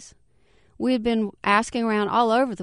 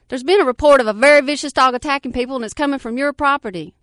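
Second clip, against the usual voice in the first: hurried official; 5 semitones higher, 7 dB louder, faster than usual. Acted. Officer on phone.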